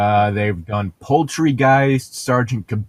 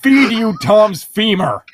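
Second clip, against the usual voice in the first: mock threat; 9 semitones higher, 6 dB louder than usual.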